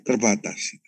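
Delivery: ordinary delivery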